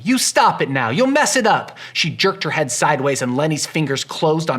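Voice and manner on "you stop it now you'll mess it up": louder and higher, angry protest; angry cry. Acted. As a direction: scold him to stop. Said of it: "she cried angrily".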